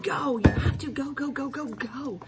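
high, loud shout of go. Acted. Girl shouting at parents to go away. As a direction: chase them out the door with a yell.

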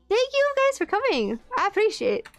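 high, cheerful thank-you; bright thanks. Real, not acted. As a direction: pitch up and beam the thanks.

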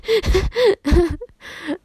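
loud high-pitched laugh; high delighted laugh. Real, not acted. Gamer laughing about dancing, loud high laugh.

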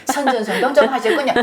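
usual speaking voice

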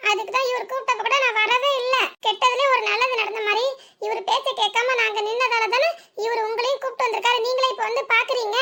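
innocent-acting young bride; young sister-in-law pleading. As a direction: sweet-talk the aunt, lightly laughing. Young woman character pleading with aunt.

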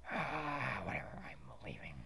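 quick dismissive whisper. Acted. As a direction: mutter it and storm off. Sulky exit.

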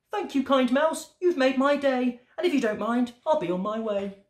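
high, grateful frog voice; thankful high-pitched character; cheerful frog. A narrator's reading. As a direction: say a bright goodbye as a storybook frog, pitched high. Frog's dialogue in a read story.

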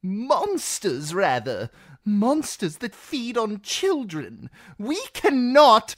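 high, loud frantic protest; outraged seneschal shouting down the council; shrieking courtier. Acted. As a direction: keep the panic going. Reznak keeps shrieking.

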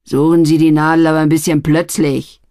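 loud rough order; threatening. A narrator's reading. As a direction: bark it as a threat. Barry pushing, threatening.

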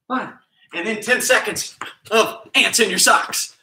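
high, bouncy workout calls; hyped coach calls. Acted. Coach hyping kids through zigzags and push-ups, high and bouncy. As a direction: pump up the kids through the drills.

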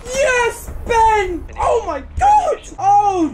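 high, loud shouts of disbelief; ecstatic cheering. Real, not acted. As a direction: scream for the teammate's clutch play. Teammate clutches the round.

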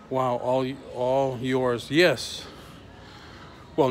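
ordinary delivery

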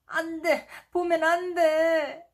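high, crying plea; panicked cry. Acted. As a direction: beg them not to look, near tears. Jiho panicking at Mini. (울먹이며 외치는 아이)

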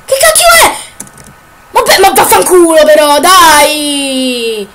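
high furious yelling outburst; explosive italian cursing. Real, not acted. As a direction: scream the swearing, fed up. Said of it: "mi sto arrabbiando troppo".

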